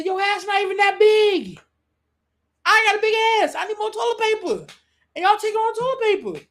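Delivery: high, loud mocking mom rant; mom scolding. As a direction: play mom scolding the hoarders. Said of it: "as my mom would say".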